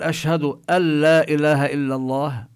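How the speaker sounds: "أن لا" said as "ألّا" with doubled lam; idgham demo. Shown as a demonstration. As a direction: merge the nun into the lam. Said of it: "هنا لا نلفظ النون، نشدد اللام". (إدغام النون في اللام بلا غنة)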